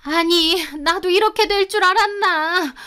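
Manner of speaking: high, flustered self-defence; cowering. A narrator's reading. Mother-in-law excuses herself to furious husband, cowering. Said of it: "아버님 앞에서 쩔쩔매더니".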